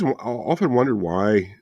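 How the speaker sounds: usual speaking voice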